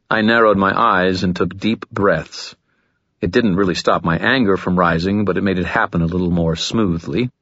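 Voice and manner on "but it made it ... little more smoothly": ordinary delivery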